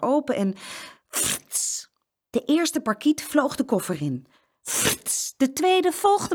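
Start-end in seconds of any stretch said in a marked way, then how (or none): 1.1-1.9 whooshing frits sound. A narrator's reading. Parakeet flutters into suitcase. 4.6-5.3 whooshing frits sound. A narrator's reading. Second parakeet flies in.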